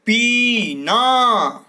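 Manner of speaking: पीना stretched very slowly, high and loud; drawn-out word. Shown as a demonstration. Teacher to pupils, slowly drawing out पीना. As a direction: sound out पीना long and slow. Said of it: "तो क्या शब्द बनेगा?".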